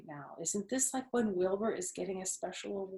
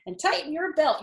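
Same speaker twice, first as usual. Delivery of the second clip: calling out; 9 semitones higher, 5 dB louder than usual. A narrator's reading. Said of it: "cried Missus Zuckerman".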